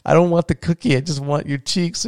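put-on high, gushing baby-talk delight; gushing dad. Dad gushing at his daughter offering a cookie, high and delighted. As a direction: melt over her like she's the cutest thing alive. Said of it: "And I was like".

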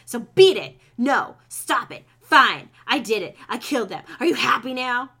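loud, angry outburst in short bursts; exasperated confession. Acted. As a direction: fire back loudly, fed up and cornered. Willow argues with unseen voice.

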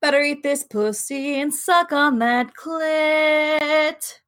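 slow, high and drawn out, hamming it up; mock sultry voice. Cohost echoes the joke in a slow, hammed-up sultry voice. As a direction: stretch every word like a sultry tease.

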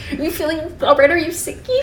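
high, cooing mock concern; babying mother. Mother fussing over sick Bella, babying.

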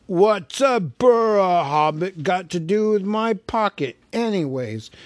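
loud, suspicious cockney troll. A narrator's reading. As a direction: grumble it as a wary troll. William questions Bilbo.

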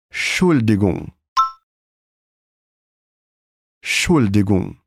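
Entschuldigung with a strong sch like Portuguese chuva; strong sch sound. Shown as a demonstration. Teacher drilling Entschuldigung with a strong sch. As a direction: lean on the sch. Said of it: "um som bem forte de C H".

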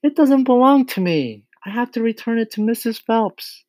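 put-on very high little-girl protest, starting loud; high, loud pleading that eases in volume; distressed child's cry. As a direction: cry out urgently in a small girl's voice. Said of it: "Matilda cried".

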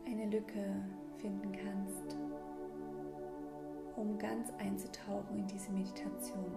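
calm breathing guide, gently paced; guided meditation. Acted. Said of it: "in diese kleine Mini-Meditation".

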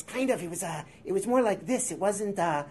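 Cheech Marin. Shown as a demonstration. Interviewee trying out old childhood imitations on request, Cheech Marin voice. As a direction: slip into a high-pitched comic impression. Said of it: "I think my Cheech Marin voice was".

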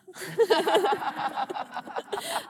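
high giggling laugh. Real, not acted. Laughs at own rhyme.

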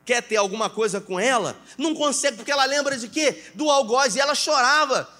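preaching at full force. Acted. Pastor pressing the congregation, fervent preaching. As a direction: preach it hard, voice lifted.

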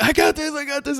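put-on high, eager boy's boast; cocky kid voice. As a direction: brag it like a keen kid. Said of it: "Lucas is like".

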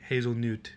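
hazelnut said as "hazel-noot"; mock mispronunciation. Shown as a demonstration. Questioning friend's pronunciation, hazelnut as "hazel-noot". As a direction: make the end of hazelnut "noot". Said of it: "why would you call it".

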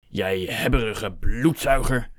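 slow, drawn-out insult; a restrained roar, stretching each word; mild angry roar. A narrator's reading. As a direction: spit out the insult slowly, mildly roared. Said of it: "brulde Hector".

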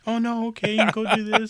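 high, sugary imitation of the agent; mock sweet voice. Friend imitating the agent's fake sweet voice, high. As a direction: mimic her fake calm. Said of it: "she's acting like".

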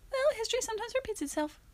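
high, quick put-on voice; quoted film retort. Quoting the film back at co-host, high put-on voice. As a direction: pitch it high and toss it off fast.

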